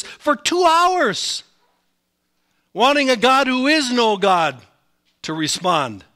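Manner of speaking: high, loud and very slow; loud drawn-out emphasis; dramatic emphasis. Real, not acted. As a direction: stretch out the two hours. Emphasising futile two hours.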